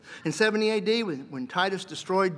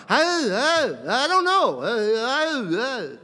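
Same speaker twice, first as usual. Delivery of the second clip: high, stammering, caught out with no answer; flustered stammer; 6 semitones higher, 5 dB louder, slower than usual. Acted. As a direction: stammer high and loud, at a loss. Claimant stammers when questioned.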